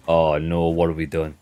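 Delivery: normal talking voice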